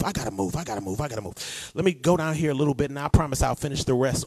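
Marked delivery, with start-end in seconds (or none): 0.0-1.7 hurried mutter to self. Real, not acted. Hurrying himself along.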